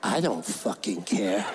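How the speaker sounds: low, slow mock bully sneer; a mock tough kid brushing him off. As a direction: drag it out like a mean kid, low. Bully answers in retold quarrel.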